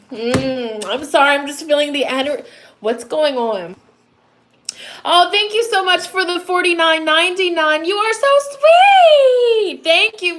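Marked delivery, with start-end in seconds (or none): 5.0-10.4 higher and louder, stretched out gushing thanks. Real, not acted. Reader thanking a viewer for a donation, gushing and high.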